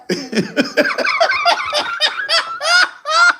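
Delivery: a long shrieking laugh; high long cackle. Real, not acted. Streamer laughing at himself, long high cackle.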